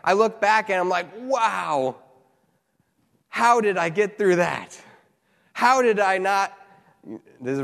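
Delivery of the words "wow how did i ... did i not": raised, emphatic retold amazement; amazed hindsight. Preacher looking back on his old life, amazed. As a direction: marvel at it with drawn-out disbelief.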